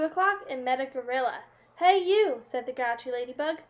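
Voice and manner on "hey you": loud, high call of challenge. A narrator's reading. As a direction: yell it out to pick a fight. Grouchy ladybug picks a fight.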